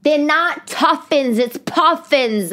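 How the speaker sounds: put-on slow, deliberate child correction. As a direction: spell it out like a know-it-all kid. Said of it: "the other girl's like".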